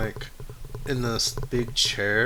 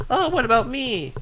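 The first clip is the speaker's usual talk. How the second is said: much higher plaintive little voice; Click whining; 12 semitones higher, 3 dB louder than usual. Acted. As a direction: left behind, pipe up high. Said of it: "Click goes".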